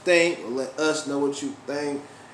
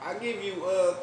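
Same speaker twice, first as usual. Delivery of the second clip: higher mock Chinese accent, ending loud; imitating the Chinese accent; 12 semitones higher, 11 dB louder than usual. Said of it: "I like when he be like".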